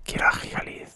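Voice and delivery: hushed, unvoiced wish; longing whisper. Real, not acted. Player wanting Gigalith, longing whisper. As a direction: whisper it with yearning.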